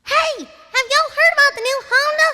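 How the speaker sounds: high, loud Southern kid cartoon voice; Apple Bloom. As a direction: belt out a car ad, ending in a demanding wail. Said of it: "hear Apple Bloom's car commercial".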